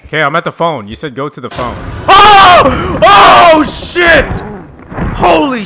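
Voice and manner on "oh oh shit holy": sudden high terrified shout; yelping in real fright at a jump scare; startled scream. Real, not acted. Streamer caught by a jump scare, screaming in fright.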